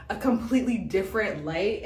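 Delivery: normal talking voice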